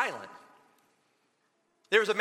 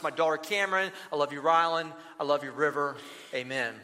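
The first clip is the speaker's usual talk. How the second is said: soft, low benediction over the people; hushed pastoral blessing, a touch lower; spoken blessing; 5 semitones lower, 3 dB quieter than usual. Acted. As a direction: bless them gently like a closing prayer. Pastor speaks a closing blessing.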